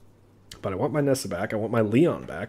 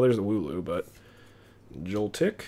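usual speaking voice

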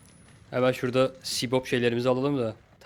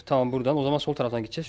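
ordinary delivery

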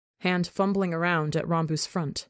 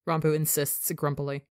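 plain reading voice

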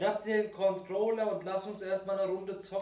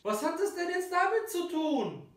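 high exasperated question; 9 semitones higher than usual. Acted. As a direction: ask in disbelief. Defensive question in argument.

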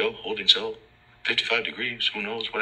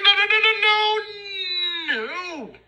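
mock squealed denial. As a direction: screech the denial like a panicked cartoon. Officer denies, mocked.

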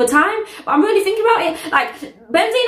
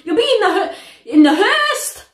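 pitched up high and rushed; excited reaction; 7 semitones higher than usual. Real, not acted. Vlogger hyped over [name]'s verse, excited. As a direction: hype about the verse, fast and high.

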